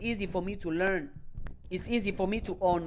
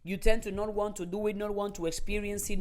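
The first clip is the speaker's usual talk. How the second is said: normal talking voice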